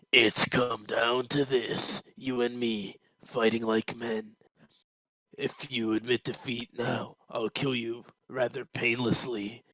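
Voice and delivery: slow, quiet, menacing threat; menacing hero. Acted. Goku slowly and quietly threatens Hitler before their fight. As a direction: lean in and threaten him slowly and softly.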